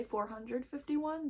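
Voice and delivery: "four hundred fifty-one" said as one full number; "four hundred fifty-one" in full, questioning; full number reading. Shown as a demonstration. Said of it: "Do they say".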